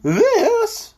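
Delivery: high, loud mock-solemn proclamation; solemn bumpy announcement. A narrator's reading. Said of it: "announced in a solemn, impressive voice".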